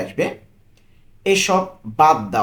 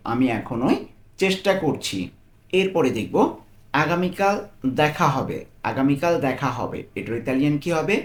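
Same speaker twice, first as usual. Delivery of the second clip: ordinary delivery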